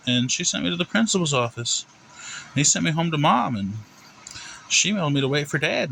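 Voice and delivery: put-on high, sing-song kid's explanation; innocent little boy. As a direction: recount it as an innocent boy to the principal. Plays Timmy explaining to judge.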